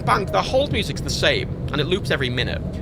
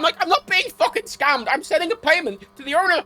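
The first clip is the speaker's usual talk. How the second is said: high-pitched, furious protest; worked-up, indignant tirade; very angry; 11 semitones higher, 5 dB louder than usual. Retold or imitated. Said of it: "I got very angry".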